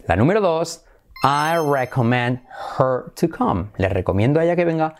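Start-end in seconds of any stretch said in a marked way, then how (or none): 1.2-3.7 very slow, separated words. Shown as a demonstration. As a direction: model the question slowly for learners. Slow model of option. (muy lento, palabra por palabra)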